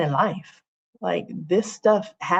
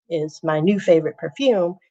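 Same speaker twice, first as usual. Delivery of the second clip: normal talking voice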